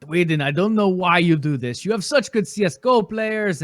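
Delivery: slipping into a slight Russian accent; a hint of Russian accent creeping in; Russian-sounding accent. Real, not acted. Co-host scolding Sweden in a light Russian accent. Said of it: "You sound Russian".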